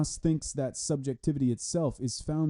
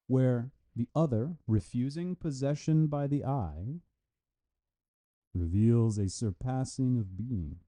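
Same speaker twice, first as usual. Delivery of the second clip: normal talking voice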